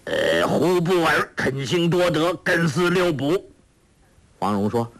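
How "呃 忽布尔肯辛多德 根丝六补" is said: a lightly voiced old villain chanting gibberish; reading strange scripture. A narrator's reading. Ouyang Feng reads the fake scripture aloud, haltingly. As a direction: sound out the foreign words haltingly. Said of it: "照着经文念道".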